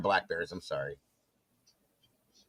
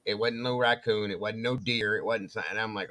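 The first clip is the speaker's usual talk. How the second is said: normal talking voice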